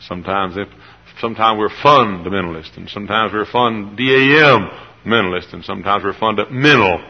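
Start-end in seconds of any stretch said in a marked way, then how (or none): 1.6-2.8 fundamentalists with a loud punch on FUN; first-syllable stress. Shown as a demonstration. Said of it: "emphasis on the first syllable". 3.3-5.5 fun, D A M, mentalists with the middle booming; second-syllable stress. Shown as a demonstration. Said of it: "emphasis on the second syllable". 6.0-7.0 fundamental with a loud punch on MEN; third-syllable stress. Shown as a demonstration. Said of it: "sometimes emphasis on".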